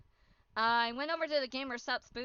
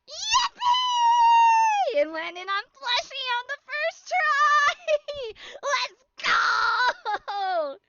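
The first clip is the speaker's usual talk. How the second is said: much higher, loud joyful yell; ecstatic cheer; 14 semitones higher, 10 dB louder, much slower than usual. Real, not acted. Vlogger celebrating a first-try plushie win, high and loud. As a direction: shout out the win.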